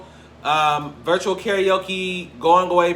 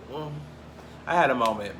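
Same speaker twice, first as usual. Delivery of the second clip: ordinary delivery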